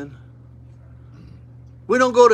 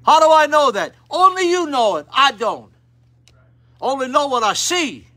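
fiery preaching; 7 semitones higher, 6 dB louder than usual. Acted. Preaching at full force.

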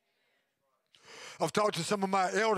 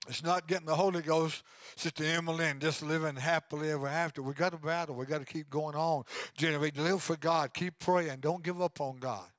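normal talking voice

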